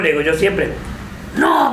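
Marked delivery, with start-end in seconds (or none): 1.4-1.7 high, drawn-out protesting "no"; retold annoyance. Peronist friend objecting to blame on Perón, annoyed. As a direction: imitate an annoyed objection, pitched well up. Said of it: "me dice, se enoja".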